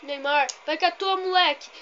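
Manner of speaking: loud rallying shout; excited urging. Real, not acted. Gamer cheering Neymar on, loud excited shout. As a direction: shout the player on, climbing as you go.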